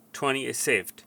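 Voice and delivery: usual speaking voice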